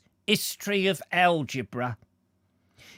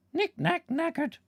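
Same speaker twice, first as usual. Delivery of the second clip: much higher playful squeak of knackered. Shown as a demonstration. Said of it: "I'm knackered already".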